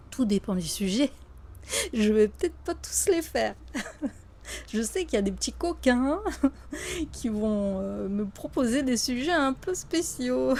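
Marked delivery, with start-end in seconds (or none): 1.6-10.6 real laughter bubbling through the words; amused chuckling. Real, not acted. Vlogger warning viewers about cheeky subject suggestions, amused chuckling. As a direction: say it teasingly, laughing between phrases.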